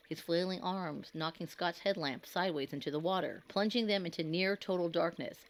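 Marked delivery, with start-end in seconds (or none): none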